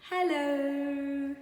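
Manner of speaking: a dog greeting the street with a word; puppy saying hello. Acted. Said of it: "He said".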